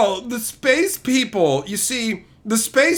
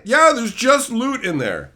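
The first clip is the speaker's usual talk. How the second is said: ordinary delivery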